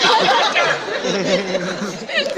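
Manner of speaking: high, loud, stretched-out yell; retold scream of pain and frustration; agonized cursing. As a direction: curse in mock agony at each jolt. Retells swearing at every bump.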